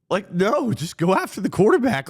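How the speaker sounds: exasperated outburst. Real, not acted. Ranting at Bills fans.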